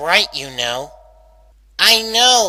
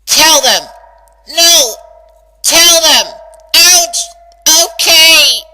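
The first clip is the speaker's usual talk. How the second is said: loud, higher back-and-forth yelling; shouting argument; 5 semitones higher, 8 dB louder than usual. Acted. Rainbow yelling at her own brain, loud and high.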